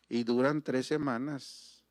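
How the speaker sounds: ordinary talk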